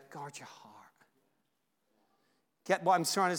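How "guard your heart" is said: soft whispered reminder. Shown as a demonstration. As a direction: whisper it inward. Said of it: "Personalize it sometimes".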